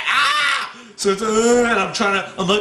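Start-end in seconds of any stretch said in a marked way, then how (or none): 0.1-0.7 high mock scream; frightened scream. Man fearing more ghosts, frightened scream. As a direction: cry out as if spooked.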